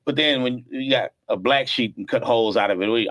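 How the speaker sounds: normal talking voice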